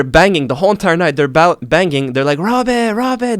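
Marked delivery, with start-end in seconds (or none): 2.4-3.4 higher mock yell of kids calling Rob; imitating kids' yell. Kids locked in cells calling for Rob all night, higher mock yell. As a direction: call the name up high, a light imitation of yelling. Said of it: "They're, like, yelling".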